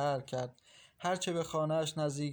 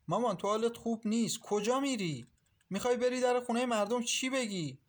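worried protest; 8 semitones higher than usual. A narrator's reading. Daughter worried, protesting to mother.

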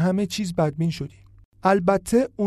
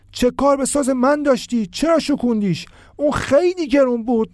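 much higher, loud anguished yelling; distraught shouting; 8 semitones higher, 4 dB louder than usual. A narrator's reading. Iman shouting in shock after his violin is smashed. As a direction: scream your grief at him. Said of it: "فریاد میزد".

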